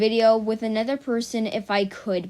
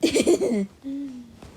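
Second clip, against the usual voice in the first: strange little burst of a laugh; odd laugh; 3 semitones higher than usual. Real, not acted. Vlogger surprised by her own laugh, odd laugh. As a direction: let an odd laugh escape. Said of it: "I don't know what that laugh was".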